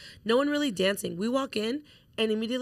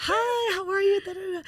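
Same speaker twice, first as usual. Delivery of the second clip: high, bright-eyed schmoozing greeting, pitched way up; networking voice; 11 semitones higher than usual. Retold or imitated. Friend greeting a stranger at the bar, high networking voice. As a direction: switch on the overly sweet networking persona. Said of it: "immediately I just go into, like, networking".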